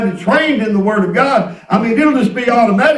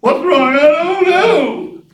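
imitating crying wife; 7 semitones higher, much slower than usual. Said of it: "she's crying".

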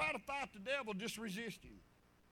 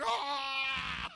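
put-on loud yelp of a struck kid; hammed-up yelp; mock pain cry; 6 dB louder than usual. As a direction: cry out like the ruler stung. Pain cry after ruler.

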